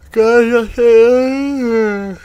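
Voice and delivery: high, loud and slow, stretched out; surprised, drawn out. Real, not acted. Surprised at arrival.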